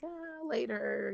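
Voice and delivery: high, slow, drawn-out mock reluctance; reluctant putting-off. Daughter putting off excited dad, reluctant. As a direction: stall with a long stretched-out reply. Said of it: "And I'm just like".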